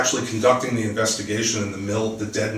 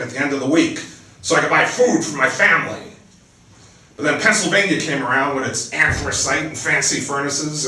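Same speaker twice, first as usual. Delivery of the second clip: livelier, higher reading voice for the book; dramatic novel reading, louder and higher than her chat; story narration; 4 semitones higher, 5 dB louder than usual. A narrator's reading. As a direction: read the scene aloud like an author at a reading. Author reads her novel aloud.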